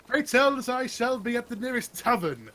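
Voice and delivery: theatrical, self-important bard; grand performer. Acted. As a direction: proclaim it like a pompous showman. Plays a grand performer character.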